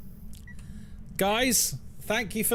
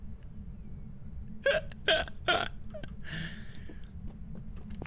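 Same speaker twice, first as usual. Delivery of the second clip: high breathless laugh; 8 dB quieter than usual. Real, not acted. Laughs at own Scottish attempt.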